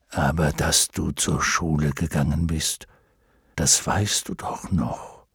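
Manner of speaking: low, measured dragon voice; dignified luck dragon. Acted. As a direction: speak low and unhurried as a wise dragon. (würdevoller Glücksdrache, tiefe Stimme)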